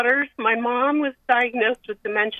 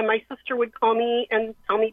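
ordinary talk

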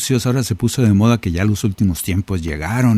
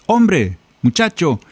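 raised, ringing call to each listener; 7 semitones higher than usual. Acted. Preacher calling out to each listener in the congregation, lifted voice. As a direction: call them out one by one, voice lifted. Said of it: "que te dice".

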